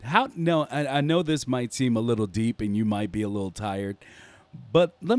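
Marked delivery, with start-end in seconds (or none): none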